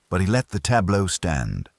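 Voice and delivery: ordinary delivery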